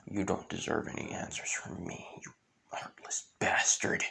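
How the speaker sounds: low, very soft, half-whispered menace; cold contempt. Retold or imitated. Asta refusing the villain answers, quiet contempt. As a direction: refuse him in a near whisper. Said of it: "With Asta saying".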